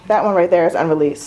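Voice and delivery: ordinary talk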